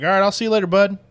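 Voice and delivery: imitated hearty sign-off; casual goodbye called out. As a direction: toss a friendly goodbye to a buddy. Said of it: "I was like".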